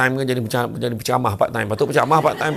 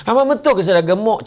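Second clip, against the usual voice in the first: coy wife; 9 semitones higher, 5 dB louder than usual. Acted. Isteri bertanya dengan manja.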